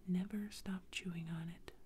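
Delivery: usual speaking voice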